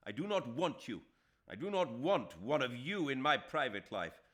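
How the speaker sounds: loud, high and furious, hammering NOT; enraged. A narrator's reading. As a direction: spit out every word in fury. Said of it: "Shame turned into rage".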